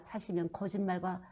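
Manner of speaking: hushed, unvoiced warning; whisper. Real, not acted. Preacher whispering a warning to congregation, hushed. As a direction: whisper it like a grave secret.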